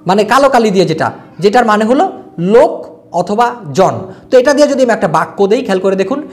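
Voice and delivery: ordinary talk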